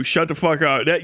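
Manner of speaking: ordinary delivery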